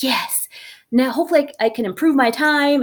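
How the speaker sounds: bubbling with eagerness to beat her time; imitating a keen teen; excited daughter. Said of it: "she was all excited".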